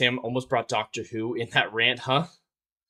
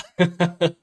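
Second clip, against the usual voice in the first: laugh; 7 semitones higher, 5 dB louder than usual. Real, not acted. Laughing at own joke.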